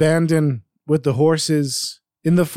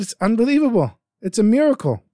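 amazed; 8 semitones higher than usual. Acted. Businessman amazed at the miracle.

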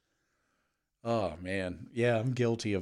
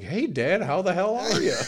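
newborn hearty greeting; 9 semitones higher, 8 dB louder than usual. Pretend. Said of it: "you came out the puss and you're like".

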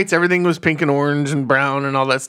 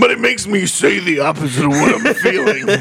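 odd new character voice; 2 semitones higher than usual. Pretend. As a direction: play a strange new character. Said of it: "I don't know what this voice is".

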